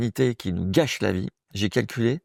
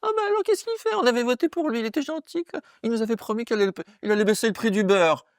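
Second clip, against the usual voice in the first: very high, silly whining voice; gullible voters; 12 semitones higher than usual. Acted. Comic mocking disappointed voters, high silly voice. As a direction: squeal it like a bewildered, naive voter. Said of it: "les quatre-vingts pour cent qui sont là, les concons".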